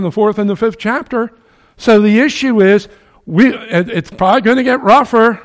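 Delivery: loud, high fiery sermon climax; preaching at full force. Acted. Preacher warning his congregation of last days, fiery. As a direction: drive the warning home to the congregation. Closing exhortation.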